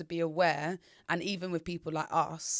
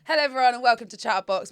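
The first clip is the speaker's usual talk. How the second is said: presenter voice; 5 semitones higher, 8 dB louder, faster than usual. Acted. Show intro.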